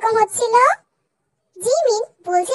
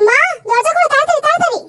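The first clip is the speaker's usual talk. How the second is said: urgent call; 6 semitones higher than usual. Acted. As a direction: hurry her mother to open the door. Rushing home, calling mother.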